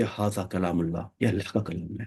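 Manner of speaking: reverent murmur. Retold or imitated. Teacher retelling the Companion's words, soft and reverent. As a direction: quietly voice his awe at the Quran. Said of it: "تو وہ کہتے".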